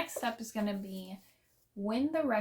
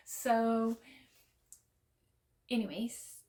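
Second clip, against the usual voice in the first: ordinary delivery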